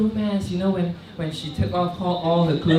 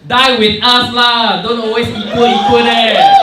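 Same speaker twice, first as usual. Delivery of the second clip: high, loud, ever-rising yell; angry shouting rant; 7 semitones higher, 8 dB louder than usual. Acted. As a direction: rant at them until screaming. Ranting at feminists.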